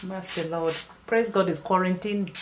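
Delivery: ordinary talk, nothing marked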